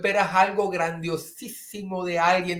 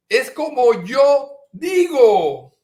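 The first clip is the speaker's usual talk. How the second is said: higher, louder insistence on YO; insisting harder; 7 semitones higher, 8 dB louder, slower than usual. Retold or imitated. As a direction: push the word yo up hard. (insistencia más aguda, recalcando yo)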